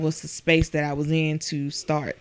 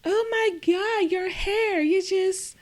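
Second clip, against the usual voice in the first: much higher, slow, gushing mock coworker; shocked coworker; 14 semitones higher, slower than usual. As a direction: imitate a stunned colleague gasping over hair. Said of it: "It was like".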